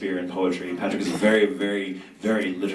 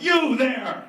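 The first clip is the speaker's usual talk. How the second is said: calling out. Acted. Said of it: "I'm like".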